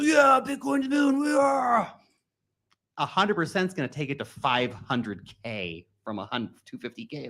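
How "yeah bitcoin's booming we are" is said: squeaky hyped-up fan voice, much higher; much higher, half-shouted mock excitement; mock euphoric cheer. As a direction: ham up a giddy crypto bro. Mocking euphoric Bitcoin fans.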